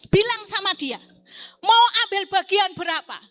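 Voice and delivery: lantang dan tinggi, seperti berseru; suara Tuhan menegur. Retold or imitated. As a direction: ucapkan tegas setengah berteriak. Tuhan ngomong sama saya. (suara Tuhan yang lantang)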